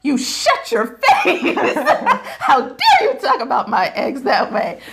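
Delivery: high, slow, laughing mock outrage at the doctor; hammed-up indignant squeal through laughter; mock outraged squeal. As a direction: squeak it out, half laughing, pretend offended. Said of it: "I had this big old crying session".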